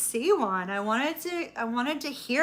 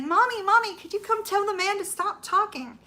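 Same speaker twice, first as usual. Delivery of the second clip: put-on 1 child's voice; 10 semitones higher, 3 dB louder than usual. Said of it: "my son wakes me up".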